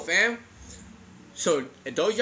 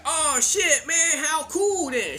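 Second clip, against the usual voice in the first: retold excitement; 7 semitones higher, much slower than usual. Kids' awed reaction acted out.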